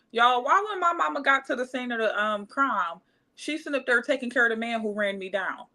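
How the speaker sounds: ordinary talk, nothing marked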